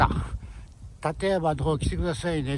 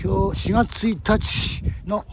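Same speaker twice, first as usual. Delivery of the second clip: slow proud presentation; 3 semitones higher, 5 dB louder, slower than usual. Real, not acted. Presenting the mountain view.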